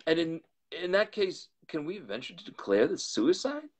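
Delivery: high, anxious rush of questions; alarmed. A narrator's reading. Lawyer to Poole puzzling over the note, high and anxious.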